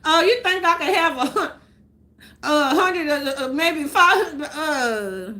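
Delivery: teasing a greedy relative's voice; mock beggar. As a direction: ham up a relative asking for cash. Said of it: "my people asking for money".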